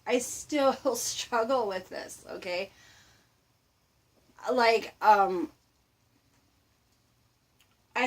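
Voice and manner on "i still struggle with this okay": slow, chuckling confession; sheepish half-laugh. Real, not acted. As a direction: laugh at yourself while admitting it. Laughing admission of own struggle.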